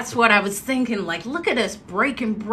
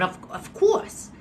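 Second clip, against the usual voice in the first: put-on Massachusetts accent; 10 semitones higher than usual. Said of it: "he had that Massachusetts".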